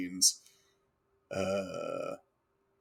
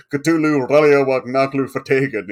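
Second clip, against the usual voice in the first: put-on old sci-fi announcer; 6 semitones higher, 12 dB louder than usual. Chanting alien language.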